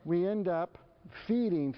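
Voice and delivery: ordinary talk, nothing marked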